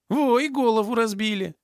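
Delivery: high, indignant whine; acted aggrieved complaint. A narrator's reading. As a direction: complain high, showing off your injury. Thug shows injury to guards. (возмущённо жалуется высоким голосом)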